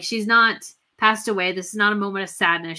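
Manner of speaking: usual speaking voice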